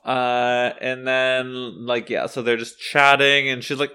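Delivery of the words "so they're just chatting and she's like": ordinary talk, nothing marked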